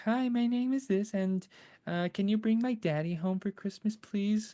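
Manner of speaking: put-on high, sweet little girl pleading. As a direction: ask Santa like a tiny hopeful girl. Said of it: "she's like".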